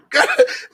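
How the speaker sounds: a high, emotional burst of laughter; high teary laugh. Real, not acted. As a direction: laugh breaking toward crying. Emotional laugh after the quote.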